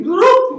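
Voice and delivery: high rising whoop; a quick high whoop as they vanish. Retold or imitated. Describing the room emptying, a high whoop. People vanishing after fellowship.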